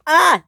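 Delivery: short high, loud "ah"; panicked cry. Acted. Sydney stuck on the bridge, panicked cry. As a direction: yelp out in panic.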